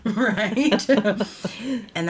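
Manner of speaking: laughing question, rising. Real, not acted. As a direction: say it through a laugh. Laughing at own crazy-love remark.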